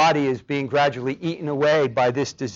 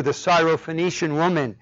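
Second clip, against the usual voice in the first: ordinary delivery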